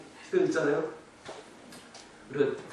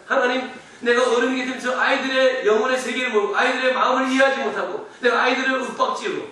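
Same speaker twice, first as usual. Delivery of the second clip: raised, loud preaching voice; forceful preaching; 5 semitones higher, 7 dB louder than usual. Real, not acted. Sunday school teacher confessing to the church, forceful preaching. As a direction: preach it urgently, pitched up.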